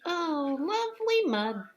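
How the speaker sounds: very high, loud duck squeak. A narrator's reading. Teacher voicing the duck for children, very high delighted squeak. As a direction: chirp it like a happy duck. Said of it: "said the duck".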